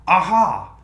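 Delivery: mock English-accented aha; a bright British aha of discovery. Imitating a dev's eureka in an English accent. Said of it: "Did you do that in an English accent on purpose?".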